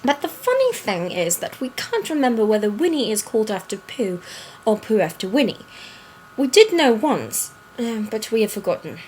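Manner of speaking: put-on storybook reading in an affected British accent. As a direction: read it aloud like a British storyteller. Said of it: "do it in that accent".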